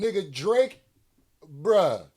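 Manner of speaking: normal talking voice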